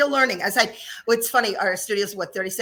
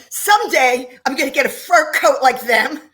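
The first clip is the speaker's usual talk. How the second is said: louder, higher, hammed-up declaration; mock-defiant vow; 5 semitones higher, 7 dB louder than usual. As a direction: proclaim it with a laugh, playfully over the top. Acting out her youthful vow, laughing.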